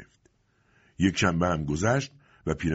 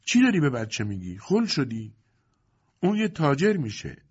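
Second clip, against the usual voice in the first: higher, indignant rebuke; startled scolding; 8 semitones higher than usual. A narrator's reading. Varvara scolds Lipa over her baby talk, startled. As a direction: scold as if taken aback. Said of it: "واروارا از این حرف یک کیک خورد".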